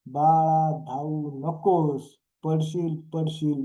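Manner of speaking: normal talking voice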